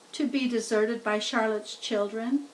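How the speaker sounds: usual speaking voice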